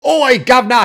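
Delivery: loud, high mock Cockney shout; over-the-top Cockney accent, shouted. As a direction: bellow it like a stage Cockney. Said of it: "horrible accent that he hates".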